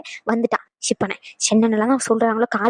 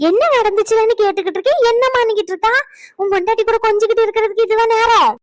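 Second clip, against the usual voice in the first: angry scolding; 8 semitones higher, 3 dB louder than usual. Acted. Mother-in-law scolds son.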